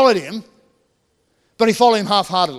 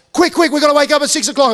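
imitating frantic wife; 6 semitones higher than usual. Wife rushing husband awake at five, mock panicked. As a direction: hurry him awake in a playful fluster. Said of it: "with Melanie going".

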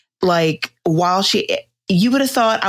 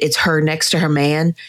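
ordinary talk, nothing marked

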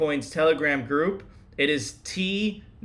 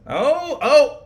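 much higher, louder oh oh; surprised exclamation; 11 semitones higher, 9 dB louder than usual. Real, not acted. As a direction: burst out in delighted surprise. Reacting to a funny score.

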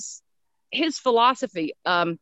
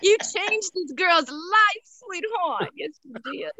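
slow, high, sweet address; heartfelt thanks to Stephen King, slow and high; tender thanks; 9 semitones higher, slower than usual. Real, not acted. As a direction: talk to him like a sweetheart.

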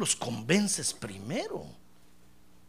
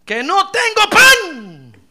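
cried out very high and loud, slow; bitter wail; 11 semitones higher, 15 dB louder, much slower than usual. Retold or imitated. Preacher voicing the bitter widow, crying out. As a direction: wail the lack of bread. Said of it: "Dice el verso doce".

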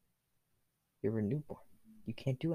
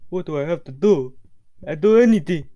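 louder, higher, eager character voice; desperate eagerness; 5 semitones higher, 7 dB louder than usual. Acted. Hero offers Death anything, desperately eager. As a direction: rise into a loud, desperate offer.

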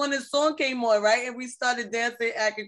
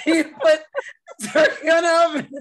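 loud, high, helpless laughter through words; laughing while talking; 5 semitones higher, 5 dB louder than usual. Real, not acted. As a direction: crack up while saying it. Cracking up over the turkey story.

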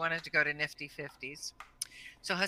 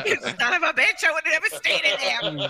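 high, loud indignant cry through laughter; laughing mock outrage; 12 semitones higher, 10 dB louder than usual. Sister cursing her for the prank, high loud laughing outrage.

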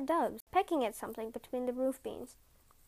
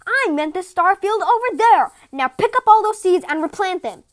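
furious shout; 7 semitones higher, 17 dB louder than usual. A narrator's reading. Father scolding Mirekan for the wrong field, furious shout. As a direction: bellow at the fool. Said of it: "he shouted".

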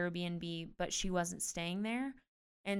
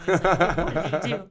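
laughing; 11 dB louder, faster than usual. Retold or imitated. Fiance's reaction retold laughing.

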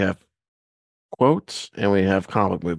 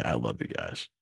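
low, much softer Groot impression; 9 semitones lower, 10 dB quieter than usual. Host moved by the Groot moment, low and hushed Groot voice. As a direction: drop low and quiet like Groot. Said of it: "we finally got to hear Groot say".

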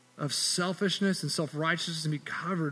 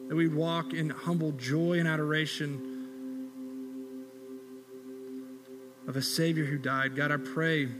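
quiet, reverent prayer voice, lower and softer than the sermon; closing prayer; 3 semitones lower, 5 dB quieter than usual. Real, not acted. Pastor leading the congregation in closing prayer, hushed and reverent. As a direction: pray it gently, unhurried.

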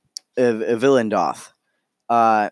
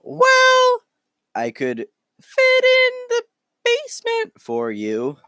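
squeaky high, dropping to normal and back; fluctuating voice; 3 dB louder, slower than usual. Acted. Innkeep offering the basement, voice fluctuating between squeaky high and normal. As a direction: weird innkeep voice that jumps up and down, slowly. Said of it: "their voice kind of keeps fluctuating up and down".

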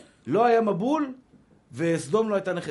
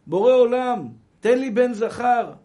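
loud, slow pleading cry; a desperate plea to the Creator, stretched out; crying out to God; 6 semitones higher, 8 dB louder, slower than usual. Retold or imitated. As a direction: call out to heaven for a son. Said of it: "כשאתה צועק".

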